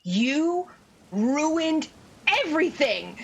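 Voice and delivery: slow, seething accusation; cussing him out. Acted. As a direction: hurl each insult slowly and deliberately. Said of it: "like, cussing him out".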